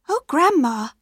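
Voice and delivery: lightly voiced small girl; girl's voice. A narrator's reading. Girl noticing grandma looks strange, lightly high. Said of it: "suddenly she was afraid".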